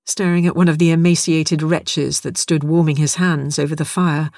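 usual speaking voice

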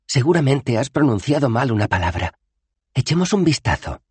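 put-on high, clear young woman; Bethan's voice. Bethan pointing out the bad spell, high and firm. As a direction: point it out firmly.

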